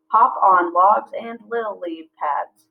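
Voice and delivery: slow, each syllable spaced out; slow syllable-counting read. Shown as a demonstration. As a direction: read as if clapping beats. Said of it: "that's seven syllables".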